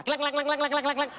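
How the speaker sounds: imitated rapid clacking. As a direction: rattle off clacks in an even rhythm. Imitates the ball dropping on New Year.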